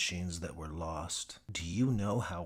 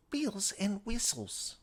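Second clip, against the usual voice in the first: put-on higher "whistles" in her accent; Gadsby correcting; 13 semitones higher than usual. Gadsby correcting Mitchell, high accented voice. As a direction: correct him sharply. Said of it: "Gadsby correcting".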